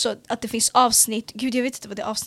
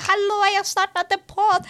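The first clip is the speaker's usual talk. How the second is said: higher, bright hello call; excited announcing; 9 semitones higher than usual. Retold or imitated. Telling youth leader in the shop she started, excited call. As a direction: call it out proudly across the shop. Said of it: "Jag bara".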